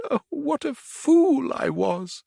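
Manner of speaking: despairing. A narrator's reading. Toad despairs.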